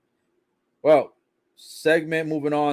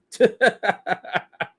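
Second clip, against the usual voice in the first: short laugh; 7 semitones higher than usual. Real, not acted. Laughing at Jarrett.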